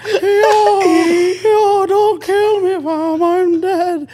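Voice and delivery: very high, loud, slow ghost cry; shrieking road man ghost; ghostly Toronto wail. Retold or imitated. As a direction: howl it like a haunted house ghost. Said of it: "haunted house where the door just opens like".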